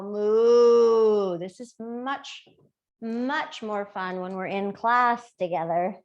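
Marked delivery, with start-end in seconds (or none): 0.0-1.4 mooing like a cow. Shown as a demonstration. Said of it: "And cow".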